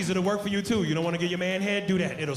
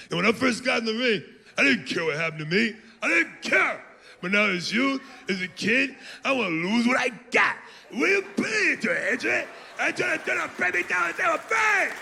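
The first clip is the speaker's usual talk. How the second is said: higher, shouting, slurred Rocky mock; Rocky losing words as he gets upset; emotional Rocky; 7 semitones higher than usual. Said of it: "He's like".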